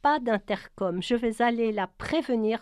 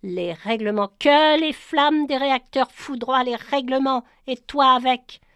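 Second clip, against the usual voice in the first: much louder, higher furious outburst; thundered; 4 semitones higher, 8 dB louder than usual. Acted. Maylin cursing the rules at Brian, thundering rage. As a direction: roar it against the rules. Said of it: "tonna Maylin".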